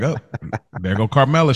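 normal talking voice

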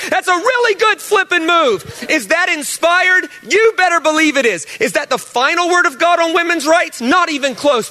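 high, emphatic, preaching full tilt; preacher hitting his peak; fired-up preaching. Real, not acted. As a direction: drive the point home with fire.

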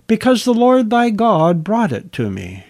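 lightly put-on piety, pitched high; pious tone. A narrator's reading. Deceiving son answering his father, in a pious tone. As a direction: raise the voice into a mildly sanctimonious register. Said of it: "he said in a pious tone".

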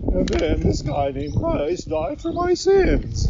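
put-on high, slow childlike beast voice; Cecil the Beast voice. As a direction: say it slowly and innocently, pitched high. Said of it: "And Cecil was like".

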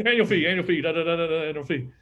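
rapid-fire auctioneer patter. Retold or imitated. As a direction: rattle it off like an auctioneer. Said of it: "like an auctioneer".

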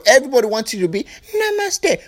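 usual speaking voice

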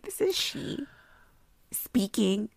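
high, far-off polite phone voice; prim phone voice. Retold or imitated. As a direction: answer the phone like a grown-up lady. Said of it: "I'm like".

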